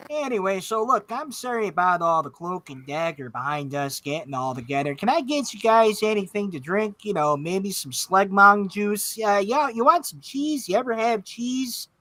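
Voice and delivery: bright, folksy Midwestern host; Midwestern accent. Acted. Orc offering drinks in a Midwestern accent. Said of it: "Midwestern Spark because accents".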